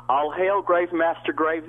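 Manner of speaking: high, declaimed Ariel in a Southern drawl; hammed-up Southern stage verse; thick Southern-accented Shakespeare. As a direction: overplay Shakespeare with a Southern accent, stretching the last line. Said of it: "Your accent".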